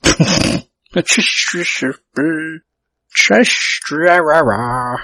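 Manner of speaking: "Trish" breaking down into babble; "Trish" stammered into nonsense syllables; tongue-tied splutter. Real, not acted. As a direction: get stuck on "Trish" and splutter helplessly.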